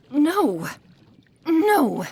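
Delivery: loud, high cries of dismay; aghast, breaking off into stammers; horrified outburst. Acted. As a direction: wail in shock at a ruined treasure. Mentor reacts to dropped vial.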